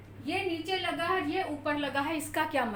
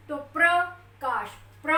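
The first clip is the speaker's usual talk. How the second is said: half प, strong र in Prakash; stress on र in Prakash; 6 dB louder than usual. Shown as a demonstration. Teacher showing Prakash with the push on र. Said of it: "र के ऊपर पूरा जोर आ रहा है".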